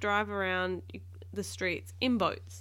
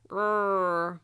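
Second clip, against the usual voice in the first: louder, drawn-out mock growl; 7 dB louder than usual. A narrator's reading. Captain straining at the squid's tentacles, growl. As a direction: growl with effort. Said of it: "growled the captain".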